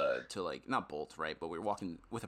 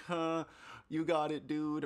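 higher-pitched uneasy placating; nervous laughing along; 6 semitones higher, slower than usual. Retold or imitated. Retelling how they placated the man on the train, nervously. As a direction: humour a scary stranger, mock nervous. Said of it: "it's super nervous laughter".

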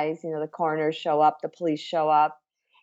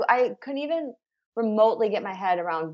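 ordinary talk, nothing marked

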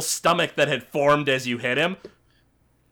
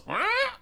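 short, high, soft squeal; boar squeal; 5 dB quieter than usual. Acted. Game master voices the boar tied to cart, little squeal. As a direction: imitate a startled boar. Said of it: "boar that you're tying to the cart goes".

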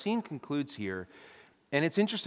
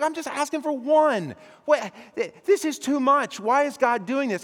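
higher, incredulous protest; Abraham overwhelmed by too many stars, higher pitch; 8 semitones higher, 5 dB louder than usual. Acted. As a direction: play Abraham unable to take it in.

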